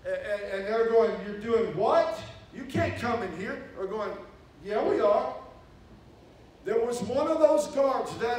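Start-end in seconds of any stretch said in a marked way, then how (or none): 1.3-3.6 mock guard disbelief. Mexican guards refusing entry. 4.6-5.3 defiant retold reply. Insisting to guards.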